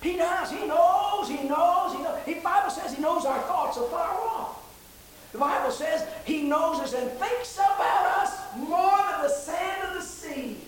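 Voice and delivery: high, rising praise, swelling and falling away; fervent preaching. Acted. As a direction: preach it with mounting fervor.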